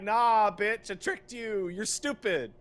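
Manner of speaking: high, mocking taunt from the game. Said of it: "it's like".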